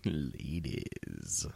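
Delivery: hushed mock seduction aimed at the ladies; mock sultry whisper. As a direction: breathe it like a cheesy seduction.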